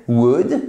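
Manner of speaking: "would" with no L sound and a short vowel; silent L. Shown as a demonstration. Teacher showing learners the silent letter, silent L. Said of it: "on ne prononce pas le L".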